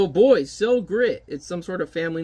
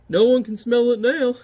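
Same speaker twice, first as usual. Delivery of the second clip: high, louder satisfied line; pleased giant; 7 semitones higher, 5 dB louder than usual. Acted. Giant pleased the smell is hidden, high voice. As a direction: sound pleased with yourself. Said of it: "giant hides soot".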